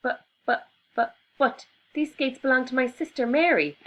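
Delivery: flustered exclaim. Acted. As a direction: sputter the buts in panic. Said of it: "exclaimed Albie".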